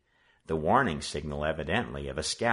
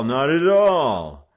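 very slow, loud sarcastic courtesy; mock polite, drawn out; 8 semitones higher, 11 dB louder, much slower than usual. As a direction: stretch the politeness to mock them. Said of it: "the leader responded politely".

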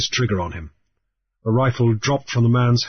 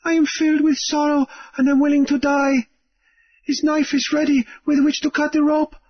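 Namu's farewell. A narrator's reading. Captive Namu bids big brother farewell, resigned and pleading. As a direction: say goodbye and mean it.

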